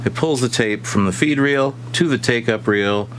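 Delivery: ordinary talk, nothing marked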